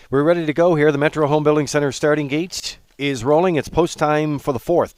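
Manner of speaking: loud track announcer call. Real, not acted. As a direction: announce the start over the PA. Track announcer starting the race.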